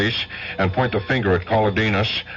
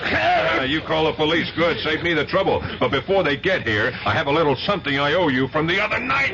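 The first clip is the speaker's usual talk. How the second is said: mocking the thug he caught; jeering, pitched higher, savouring it; detective gloating over the eavesdropper he caught; taunting; 8 semitones higher than usual.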